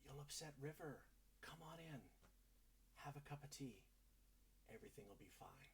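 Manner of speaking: soft, hushed, falsely soothing; hushed reassurance. Acted. Impostor inviting Glamdry in for tea, hushed and falsely soothing. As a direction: coax him in quietly.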